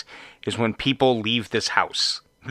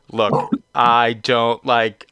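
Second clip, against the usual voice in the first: ordinary delivery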